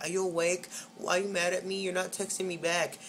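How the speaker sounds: a hint of a clingy texter; imitating pestering cousin. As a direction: lightly mimic someone nagging for a reply. Mimicking cousin's pestering texts.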